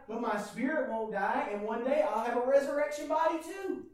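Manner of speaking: ordinary talk, nothing marked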